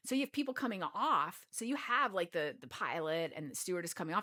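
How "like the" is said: normal talking voice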